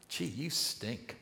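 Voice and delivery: slow, soft mock pig talk. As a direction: play a pig teasing another pig, lightly. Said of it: "I never heard a pig say to another pig".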